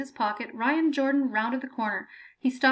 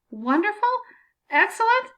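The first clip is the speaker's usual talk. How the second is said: incredulous, climbing much higher and louder; outraged cry of disbelief from the pit; near scream; 10 semitones higher, 6 dB louder than usual. A narrator's reading. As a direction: hurl it back in disbelief. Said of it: "Benjamin nearly screamed back at her".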